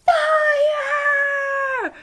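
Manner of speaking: high, loud panicked scream; full retold panic shriek. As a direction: yell fire at the top of your lungs. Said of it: "burst out of the elevator screaming".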